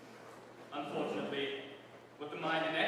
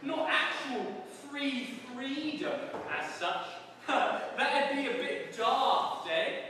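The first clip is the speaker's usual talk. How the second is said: higher, louder jeering prison guard; hammed-up cockney warder; gloating warder; 9 semitones higher, 3 dB louder, much slower than usual. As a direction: crow it like a smug jailer. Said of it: "said the warder".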